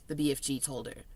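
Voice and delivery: ordinary delivery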